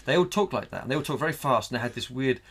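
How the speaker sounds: ordinary delivery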